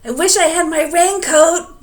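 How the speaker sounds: high, loud yell over the storm; boy yelling into the rain. A narrator's reading. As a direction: shout against the wind. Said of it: "yelled Elmer".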